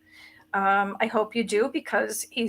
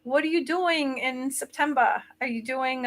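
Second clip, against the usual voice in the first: put-on Scottish accent; 4 semitones higher than usual. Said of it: "I hate doing the Scottish accent".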